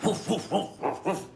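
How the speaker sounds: high, breathy woofs; imitated dog barking. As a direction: bark like a big sniffer dog. Imitates the big sniffer dog.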